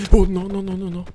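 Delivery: high, loud panicked no's. Real, not acted. Gamer spotting a monster, high panicked yelps. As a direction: yelp as the monster appears.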